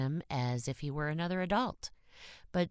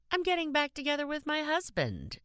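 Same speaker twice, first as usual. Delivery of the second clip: much higher, bright happy announcement; enthusiastic; 14 semitones higher than usual. Acted. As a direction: share big news with delight. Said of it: "she said enthusiastically".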